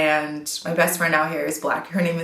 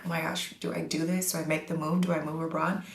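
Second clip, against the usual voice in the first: softer, unsure self-questioning; hesitant inner voice; 7 dB quieter, faster than usual. Retold or imitated. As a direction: murmur it like a private worry. Voicing hesitant girls considering moving.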